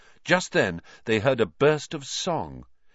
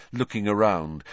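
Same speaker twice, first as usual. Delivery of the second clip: plain reading voice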